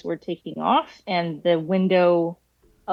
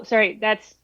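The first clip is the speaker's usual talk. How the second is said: ordinary talk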